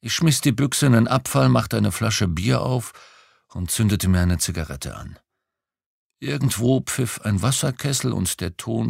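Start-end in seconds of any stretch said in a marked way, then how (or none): none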